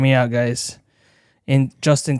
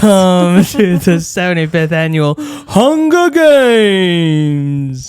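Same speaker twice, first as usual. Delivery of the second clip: slow, higher, loud showman announcer, laughing through it; mock announcer; 4 semitones higher, 6 dB louder, much slower than usual. Husband opens the podcast as a hammed-up games announcer. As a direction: boom it out like a games host opening the show. Hams up a Hunger Games opener.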